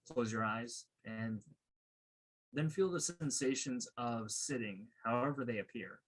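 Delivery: calm, unhurried meditation guide with long pauses; guided meditation. Real, not acted. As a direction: guide them gently into stillness, leaving space between phrases.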